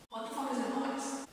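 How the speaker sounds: very soft, hushed alarm; whispered thought. Retold or imitated. Alone in the house hearing a noise, whispered question. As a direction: whisper it to yourself, wary. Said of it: "I think".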